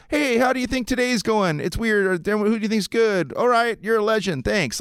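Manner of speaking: lightly voiced reporter tossing out empty questions; mock clueless reporter. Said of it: "Anything, anything like".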